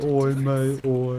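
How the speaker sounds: half-hearted attempt at a British accent. Shown as a demonstration. Streamer failing to do it on command, tentative British accent. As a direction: try a British accent without pushing it. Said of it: "a British voice on".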